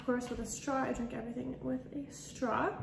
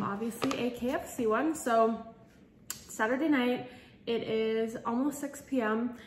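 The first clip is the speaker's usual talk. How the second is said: usual speaking voice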